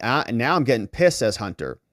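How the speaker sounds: usual speaking voice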